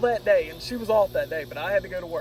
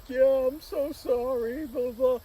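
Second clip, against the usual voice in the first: high, slow mock-tearful apology; mock crying; 6 semitones higher, slower than usual. Imitating his girlfriend breaking up with him, mock weepy. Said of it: "Why are you crying?".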